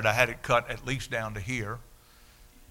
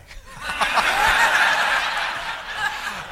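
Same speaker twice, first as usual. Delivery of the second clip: squeaky airless laugh; a real burst of laughter; high breathy laugh; 6 dB louder than usual. Real, not acted. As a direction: crack up at your own cheek. Laughs at own cheeky question.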